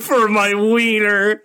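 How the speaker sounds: high, drawn-out mock cheer; silly high voice. Co-host finishing the film character's line, silly high voice. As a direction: stretch it out high and goofy.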